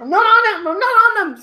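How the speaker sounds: high, loud denial; indignant protest. Real, not acted. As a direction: protest loudly against the teasing. Protesting under friends' teasing.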